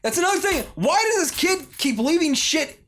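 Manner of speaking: high, exasperated ranting; real frustration rising high; frustrated rant. Real, not acted. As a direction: vent about the food left lying around. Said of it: "I just get really frustrated".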